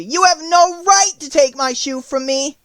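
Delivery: put-on high, loud protesting girl; indignant cry. A narrator's reading. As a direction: protest loudly as a wronged child. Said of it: "cried Dorothy".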